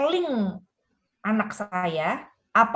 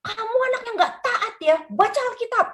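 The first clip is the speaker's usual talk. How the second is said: mock scolding parent; 13 semitones higher, faster than usual. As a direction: lord it over the kid like a bossy parent. Mimicking parent scolding child. (meniru orang tua yang memarahi anak, tinggi dan keras)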